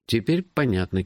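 compliant echo. A narrator's reading. Hero nods to his friend's explanation, compliantly echoing.

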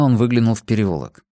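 ordinary delivery

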